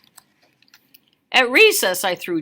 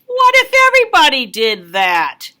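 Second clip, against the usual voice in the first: loud scolding adult voice; stern manager; 3 semitones higher, 8 dB louder than usual. Acted. Manager scolding the kid with the cart, loud and stern. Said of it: "the manager stopped me and said".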